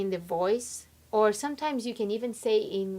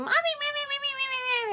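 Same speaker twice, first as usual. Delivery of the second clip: very high, squeaky mock child babble; whining six-year-old; much faster than usual. Tired child nagging mother after school, high mock whine. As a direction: pester in a rapid tiny whine. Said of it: "when my six years old".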